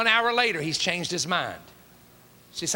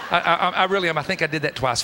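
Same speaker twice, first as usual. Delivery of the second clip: normal talking voice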